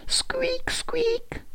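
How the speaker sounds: tiny, very high squeaks; high-pitched mouse peeps; squeaking mouse. A narrator's reading. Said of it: "he heard the squeaking of a mouse".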